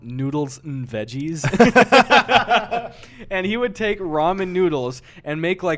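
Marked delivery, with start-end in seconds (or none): 1.5-3.2 loud high laugh bursting out; big laugh. Real, not acted. Laughing at dad's noodles and veggies, loud high burst.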